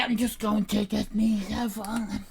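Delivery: normal talking voice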